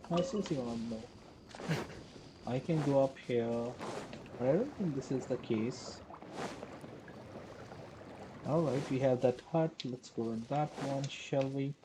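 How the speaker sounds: usual speaking voice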